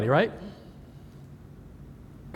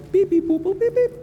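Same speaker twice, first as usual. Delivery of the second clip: high-pitched mimicked keypad beeps; imitated dial tones. Shown as a demonstration. Presenter mimes dialing the phone, high electronic beeps. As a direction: beep and boop like a phone being dialed.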